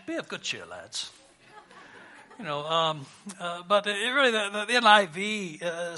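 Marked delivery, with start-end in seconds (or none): 0.1-1.1 lightly put-on British accent, softly dropping away; a hint of British chap, low final word; mock British. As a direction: toss it off in playful British. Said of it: "That sound British".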